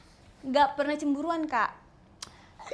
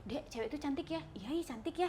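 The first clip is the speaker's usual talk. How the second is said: quiet aside; 11 dB quieter than usual. Retold or imitated. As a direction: murmur it as a casual aside to a partner. Retold aside about passing girl. (celetukan pelan)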